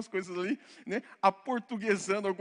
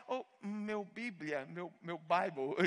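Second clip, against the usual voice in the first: slow, drawn-out fake forgetting of Portuguese; slow hammed-up Brazilian three months in America; mock Americanized Brazilian; 3 semitones lower, 3 dB quieter, much slower than usual. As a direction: stretch out "meu Bíblia" like you've lost your Portuguese. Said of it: "um brasileiro que tá três meses e fala".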